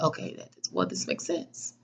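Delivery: breathless whisper to herself. Retold or imitated. Calming herself down after a rant, whispering. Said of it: "And I was like".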